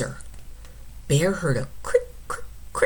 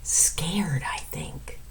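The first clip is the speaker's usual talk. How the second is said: soft whispered aside; confiding whisper; 7 dB quieter, slower than usual. Real, not acted.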